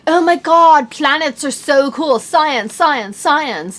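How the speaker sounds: loud mock dude enthusiasm; mock excited dude; bro voice. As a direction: parody a hyped boy-product voice. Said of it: "the guy stuff is all like".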